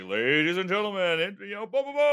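loud, rising show announcer voice. Acted. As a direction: build up like introducing the show. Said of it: "the announcer, it's like".

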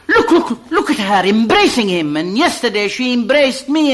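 excited. Acted. Narrator urges his friend to look at her, excited laughter. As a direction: point her out in eager disbelief.

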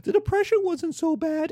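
high mocking fanboy voice. Lecturer mocking a Twitter fanboy, high sneer. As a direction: sneer it like a clueless online fan. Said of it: "fanboys on Twitter who are like".

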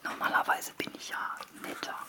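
hushed whisper. Real, not acted. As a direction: keep it to a whisper. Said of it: "continues whispering".

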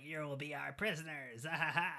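hammed-up villain line ending in a cackle; mock evil villain. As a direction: play the cartoon baddie with a laugh.

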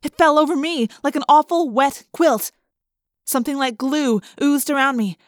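usual speaking voice

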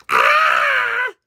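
very high screech; very high cartoon scream; acted scream. A narrator's reading. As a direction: let out a piercing creature screech. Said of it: "screamed the".

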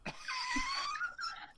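shrieking burst of laughter; high squeal laugh. Real, not acted.

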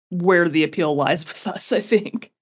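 ordinary talk

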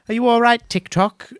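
put-on a little girl calling up high; Dorothy. Dorothy asks the Tin Man if he is all right, high and girlish. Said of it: "she goes".

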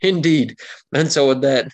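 ordinary talk

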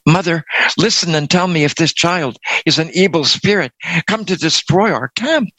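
fast, high, frightened plea; urgent whisper aloud. A narrator's reading. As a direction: rush the words out in fear. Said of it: "she whispered aloud".

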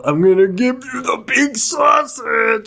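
high, slow, silly put-on voice; hammed-up innuendo. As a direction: draw it out with mock grandeur. Mock butcher innuendo in put-on voice.

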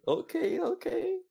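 mocking high okay okay; squeaky imitation of teammates; frat bro. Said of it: "everyone, like, saying".

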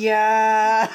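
lightly imitated Uncle Roger exclamation; a hint of Uncle Roger's aya. Cohost quotes the character sounding like Uncle Roger, light mimic. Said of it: "exactly the same way as Uncle Roger".

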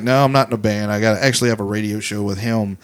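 low, soft flat answer; imitating Wayne. Wayne deadpans to the drunk woman, low and soft. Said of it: "And Wayne's like".

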